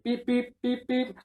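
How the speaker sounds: high, monotone beeps; imitated horn honk. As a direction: mimic honking a horn with your voice. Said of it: "pretends to honk the horn".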